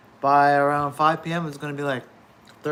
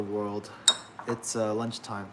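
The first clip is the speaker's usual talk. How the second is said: usual speaking voice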